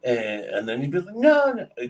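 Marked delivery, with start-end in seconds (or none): 1.1-1.9 very high, urgent no, no; pleading manager. Retold or imitated. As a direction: cry out to stop him from leaving. Manager begging him to stay.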